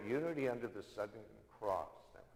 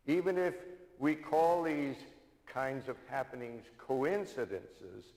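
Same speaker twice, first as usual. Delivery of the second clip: ordinary delivery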